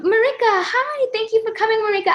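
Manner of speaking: high, bright welcome by name; excited welcome. Real, not acted. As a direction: welcome her like a dear friend. Host calls a fan by name.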